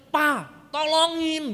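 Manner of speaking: high, loud, drawn-out cry for Papa; whiny childish pleading. Retold or imitated. Fallen child begging father to help lift the bike, whiny. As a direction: imitate a spoiled child wailing for help. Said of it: "bersikap manja begitu kepada orang tuanya".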